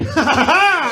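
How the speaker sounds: loud, high "ha ha ha"; mock attacking cry. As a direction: burst out loudly at the monsters. Acted counterattack on the fears.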